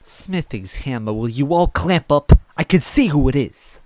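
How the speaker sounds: loud high irritated outburst; exasperated. Acted. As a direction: tell them all to clamp up. Red silences the others.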